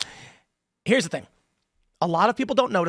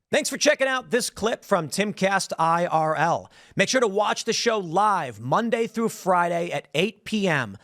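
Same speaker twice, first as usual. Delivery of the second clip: outro read; 2 semitones higher than usual. Acted. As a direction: sign off the clip like a host.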